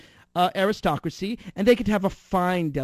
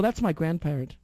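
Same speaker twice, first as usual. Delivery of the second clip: ordinary talk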